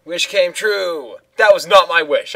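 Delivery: loud, high indignant complaint; outraged protest. Real, not acted. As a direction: protest the unfair twist. Game twists his wish, outrage.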